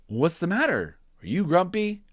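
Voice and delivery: caring question; concerned Jim. Acted.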